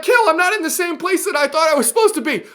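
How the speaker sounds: high, fake-weepy whine; mock crying viewer. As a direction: pretend to sob like a lost fan. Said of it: "And you're like".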